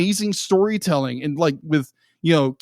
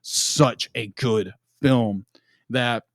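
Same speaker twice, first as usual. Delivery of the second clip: hushed awe; 6 semitones lower, 4 dB quieter, slower than usual. Real, not acted. Recalls slow clapping at the film.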